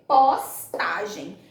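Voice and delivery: "postagem" slowly broken after pos; syllable by syllable. Shown as a demonstration. Teacher dictating the three-syllable answer, "postagem" broken up. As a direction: pause inside "postagem": pos, tagem. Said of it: "Trissílaba".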